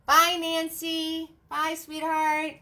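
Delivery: high, slow, sweet farewell; drawn-out fond goodbye. Real, not acted. Saying goodbye to a viewer, high and sweet.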